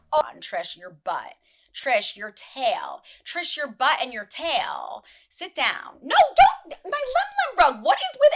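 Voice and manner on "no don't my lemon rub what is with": high, loud startled protest; alarmed cry. Real, not acted. Host crying out as the pet ruins the set. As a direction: stop them before they wreck it.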